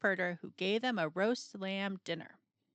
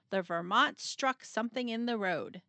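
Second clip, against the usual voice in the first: ordinary delivery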